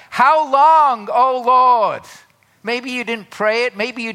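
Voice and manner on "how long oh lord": loud, high plea; anguished cry of a struggling believer; lament cry. Acted. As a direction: cry out to God in distress. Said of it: "think about that".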